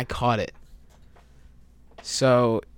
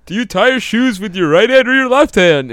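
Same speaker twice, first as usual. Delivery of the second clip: high, loud parody of a host; mock-enthusiastic question; 7 semitones higher, 8 dB louder than usual. Host parodying their podcast questions, loud mock enthusiasm. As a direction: holler the question with overblown eagerness.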